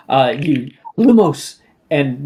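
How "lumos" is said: loud, high Lumos, calling it out; spell incantation. Acted. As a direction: call out the spell with force. GM voicing the spell cast.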